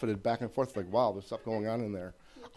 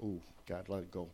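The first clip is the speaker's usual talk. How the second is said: resigned; 4 semitones lower, 12 dB quieter than usual. Retold or imitated. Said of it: "come back and go".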